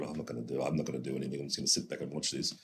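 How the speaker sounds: low, hushed aside to oneself; private thought. Retold or imitated. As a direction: voice a secret decision under your breath. Voicing own private thought on stage.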